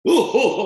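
hammed-up falsetto ooh laugh; imitated high laugh. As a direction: ape a giggling teammate's squeal. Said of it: "he laughed".

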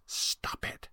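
sharp unvoiced whisper; mouthed words. A narrator's reading. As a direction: whisper it behind his back. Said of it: "mouthed the words".

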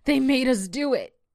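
put-on high, loud protesting girl; high-pitched outburst, falling at the end; crying out. A narrator's reading. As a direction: cry out in desperate protest. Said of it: "Shelby cried in the rain".

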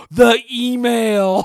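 slow, stretched, loud cry of alarm; panicked exclamation. Retold or imitated. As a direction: blurt it out in shock. Retelling panicked outburst.

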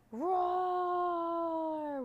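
long, high, loud roar; dragon's roar. A narrator's reading. Dragon attacks the boy, long loud roar. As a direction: roar out and hold it. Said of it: "roared the dragon again".

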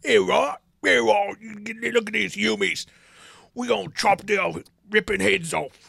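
loud, high mock-Cockney orc; rally the orcs in goofy Cockney. Orc spotting humans to attack, goofy Cockney war cry. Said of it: "these goofy accents".

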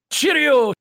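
high, loud hammed-up Scottish-British cheerio; a burst of mock Scottish accent, loud and high. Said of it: "can he do a Scottish accent?".

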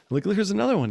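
high, loud, urgent pointing-out; excited retold call. Showing the neighbor another orb, excited call. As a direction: re-enact a breathless-with-excitement sighting.

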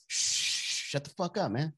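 hissed shush then a soft, hushed curse; hushed mock scolding. Mayor in the back of the nightclub hushing a fan, hushed. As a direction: hush him fast so nobody hears.

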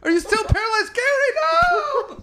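high, crying mock wails, slowing down; hammed-up anguish. Podcaster acting a crying friend seeing Gary again. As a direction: wail his name in fake despair. Mock tearful reunion yelling.

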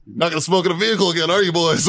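high, fast, teasing mock colonel. Colonel teasing the coughing soldiers, high fast mock scold. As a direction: rib the boys like a smug officer.

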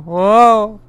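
loud high startled whoa; sudden loud startled cry; surprised whoa. Real, not acted. Chair suddenly moves.

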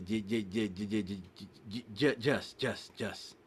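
usual speaking voice

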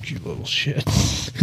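usual speaking voice

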